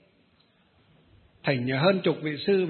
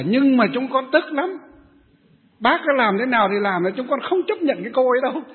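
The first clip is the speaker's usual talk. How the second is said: higher and louder, refusing flatly; stubborn indignation; 6 semitones higher, 4 dB louder than usual. Retold or imitated. Old women refuse to forgive the woman, higher and louder. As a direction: dig in your heels, aggrieved. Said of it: "Các cụ bảo".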